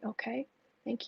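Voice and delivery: hushed, voiceless thank you; whispered thanks. Real, not acted. Host thanks a comforting viewer in a whisper. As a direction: whisper your thanks, moved.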